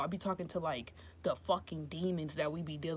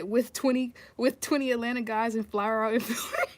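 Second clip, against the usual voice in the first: higher, louder, tipping into laughter; amused, starting to laugh; 5 semitones higher, 6 dB louder than usual. Real, not acted. Reader repeating a viewer's dating-show joke, amused and breaking up. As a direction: read it out while starting to crack up.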